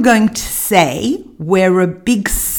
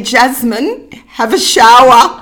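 laughing; 3 semitones higher, 3 dB louder than usual. Retold or imitated. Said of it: "And I'd say".

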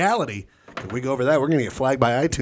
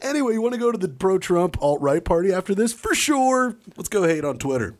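mock bro voice; 8 semitones higher than usual. Mocking alt-right bros.

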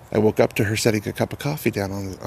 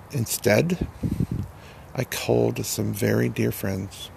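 ordinary delivery

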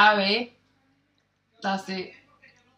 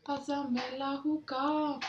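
Dominican accent; 4 semitones higher, 9 dB quieter, much slower than usual. Shown as a demonstration. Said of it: "Dominican use so much slang".

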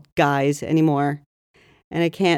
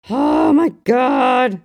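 shocked; 6 semitones higher, 5 dB louder, much slower than usual. Real, not acted. Shocked reaction to cage story.

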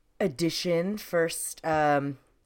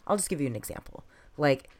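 ordinary talk, nothing marked

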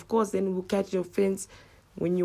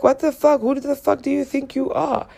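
high, indignant comeback; retold outraged retort; aggressive retort; 8 semitones higher, 4 dB louder than usual. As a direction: snap back aggressively, pitched up. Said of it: "I know it comes off as aggressive".

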